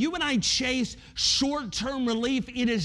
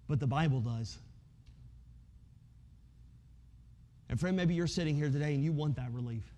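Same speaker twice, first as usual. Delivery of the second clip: soft personal appeal; 8 semitones lower, 8 dB quieter than usual. Real, not acted. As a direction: lower and softer, speaking close to one listener. Preacher softens to personal appeal.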